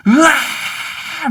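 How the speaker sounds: long, high charging yell; drawn-out acted war cry; battle cry. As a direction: yell as the army rushes forward. Soldiers charging behind their general.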